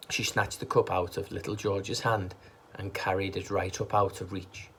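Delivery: plain reading voice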